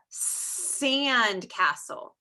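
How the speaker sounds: "sand castle" with a drawn-out S; emphasized S sound. Shown as a demonstration. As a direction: hiss into the start of "sand castle". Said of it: "What's the first sound that you hear".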